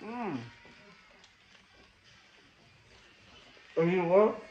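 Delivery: chewing while talking; speaking between bites; mouth full of food. Real, not acted. Seen 1: Cheeks full, chewing after big sandwich bite, wiping mouth with napkin.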